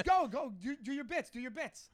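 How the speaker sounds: laughing mock host pressing him; insistent host. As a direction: hurry him up, go go go. Host urging him again.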